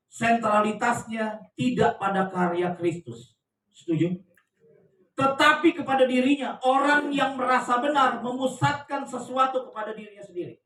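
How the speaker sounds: loud, emphatic sermon delivery, slow in places; forceful preaching. Acted. Pastor preaching to the congregation about self-righteousness, loud and forceful. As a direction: drive the point home to the congregation.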